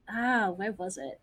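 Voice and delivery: ordinary talk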